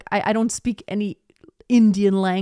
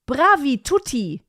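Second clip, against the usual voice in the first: put-on higher, slow, louder German-accented "Bravi tutti"; "Bravi tutti" with hard German T's, slow and louder; German reading Italian; 5 semitones higher, 4 dB louder than usual. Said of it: "That's what a German would say".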